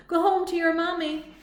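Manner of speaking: mock the crybaby; teasing taunt. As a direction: Clunk jeering at Bimbot. Clunk teasing.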